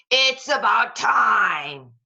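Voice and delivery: loud, slow vulture grumble; a loud, slow villain bird. Acted. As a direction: drag it out like a greedy bird. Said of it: "said one of the vultures".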